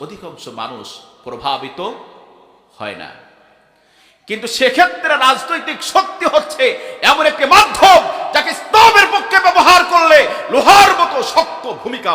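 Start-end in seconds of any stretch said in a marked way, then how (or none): 4.3-12.1 loud, high preaching shout; fiery preaching. Real, not acted. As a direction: thunder it out to the crowd. Preacher at full force. (জোরালো গলায় চিৎকার করে ওয়াজ)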